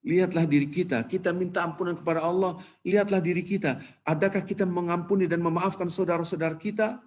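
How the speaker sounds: retold preacher's stirring exhortation; impassioned preacher. Khotib urging pilgrims to look at themselves, impassioned. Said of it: "ini yang menyebabkan orang nangis".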